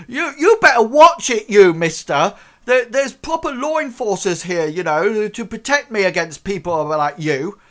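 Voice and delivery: high, loud huffy warning; indignant threat. Acted. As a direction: wag a finger and threaten. Crank threatens after being knocked.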